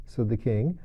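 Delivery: usual speaking voice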